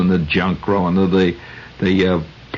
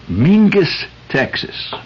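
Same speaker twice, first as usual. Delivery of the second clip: higher, twangy Texas drawl on the town name; Mingus Texas accent; 9 semitones higher, slower than usual. Shown as a demonstration. Radio host saluting a tough town, in a Texas accent. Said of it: "I'm gonna fall into my Mingus, Texas accent".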